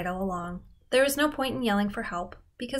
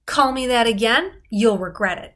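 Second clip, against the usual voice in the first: loud, steady warning; no shake, loud firm threat; 8 dB louder than usual. Retold or imitated. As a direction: say it like you mean business. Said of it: "There wouldn't be any shake in his voice".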